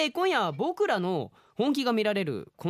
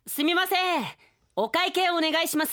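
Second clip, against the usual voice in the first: much higher, louder comic customer voice; 12 semitones higher, 4 dB louder, slower than usual. Acted. Customer calling the waitress for the bill in a much higher, comic voice. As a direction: call for the bill in a funny squeaky voice.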